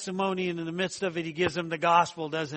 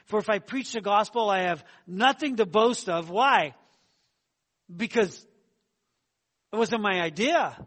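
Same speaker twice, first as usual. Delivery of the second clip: louder, rising preacher's push; fervent preaching; 3 semitones higher, 5 dB louder than usual. Acted. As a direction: preach it with full conviction. Preaching the verse with fervour.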